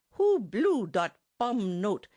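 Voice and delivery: put-on slow, loud stern German-accented demand. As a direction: bandleader sternly demanding who blew the bum note, slow and loud. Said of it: "he sternly demanded".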